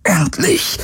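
high, forced challenge; strained call. A narrator's reading. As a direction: call it out with failing strength. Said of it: "rief er".

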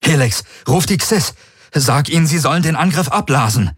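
urgent order. A narrator's reading. Garland orders Helix to halt the attack, urgent.

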